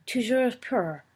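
a first, lighter cry of the toast Toujours pur; crowd cry. A narrator's reading. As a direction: answer the toast with Toujours pur, not yet full. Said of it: "cried every voice".

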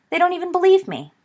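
high, loud indignant complaint; indignant outburst. Acted. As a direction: protest it, aggrieved. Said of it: "loudly indignant".

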